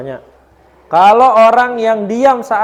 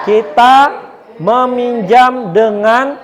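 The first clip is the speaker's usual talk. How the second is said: loud, very slow read-aloud chant; slow class recitation; 4 semitones higher, 5 dB louder, much slower than usual. Shown as a demonstration. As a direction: lead the class reading together slowly.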